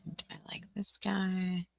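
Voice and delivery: whispered, no voice. Real, not acted. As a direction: whisper it to yourself. Whispering over slide design.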